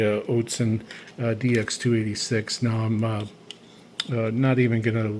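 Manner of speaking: ordinary delivery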